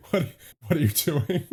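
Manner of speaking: laughing disbelief. Real, not acted. Laughing at his team's collapse.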